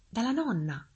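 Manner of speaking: put-on high-pitched storybook child; high child voice, falling at the end; little girl. A narrator's reading. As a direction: answer as an innocent little girl. Girl answers the wolf.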